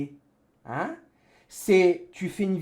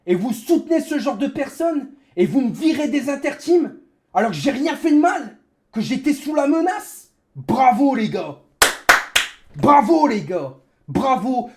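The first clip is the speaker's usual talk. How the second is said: loud, high, furious rant; bitter sarcastic shouting; raging gamer; 4 semitones higher, 5 dB louder than usual. Real, not acted. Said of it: "c'est ma rage qui parle".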